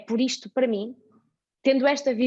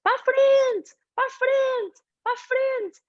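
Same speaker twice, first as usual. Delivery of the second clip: fan yelling; 14 semitones higher, slower than usual. Retold or imitated. A man behind her at the football match, shouting at the players. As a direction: bellow it like a pushy fan urging the team forward. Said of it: "um senhor atrás de mim a dizer".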